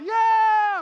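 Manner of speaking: very loud, high gloating cheer; victory yell. Retold or imitated. Dad winning while the kid cries, triumphant yell. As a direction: whoop it right over the loser.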